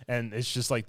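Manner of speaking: usual speaking voice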